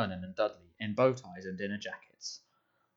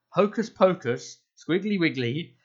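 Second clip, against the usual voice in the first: high, loud and slow mock incantation; fierce mock spell; 8 semitones higher, 7 dB louder, slower than usual. A narrator's reading. Harry scaring Dudley with nonsense spell, slow fierce chant. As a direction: chant it slowly to spook him. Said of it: "in a fierce voice".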